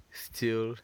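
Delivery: ordinary talk, nothing marked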